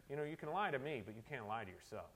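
lightly voiced sleepy grandpa, low and soft; imitating a drowsy old grandfather, trailing down quietly; a hint of a wise, half-asleep grandpa. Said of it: "half asleep".

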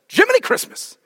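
high-pitched exasperated outburst; exasperated exclamation. Retold or imitated. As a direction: mimic grandfather's exasperated mild oath. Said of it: "coolest and weirdest exasperated phrase".

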